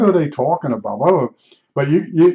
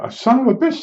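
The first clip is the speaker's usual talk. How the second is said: surprised; 9 semitones higher than usual. Retold or imitated. Surprised the neighbor took advice.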